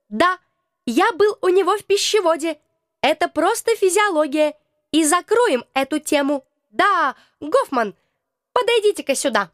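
a hint of a prim little being; small creature voice. Creature Krokotuk speaks.

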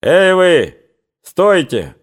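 calling out. A narrator's reading. Cossack hailing soldiers some distance away, shouting. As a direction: call sharply across open ground. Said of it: "заслыша крик".

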